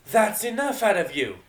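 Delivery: loud, high bellow. Acted. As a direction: bellow to stop the fight. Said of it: "came a bellowing voice".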